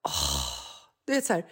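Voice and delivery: ordinary delivery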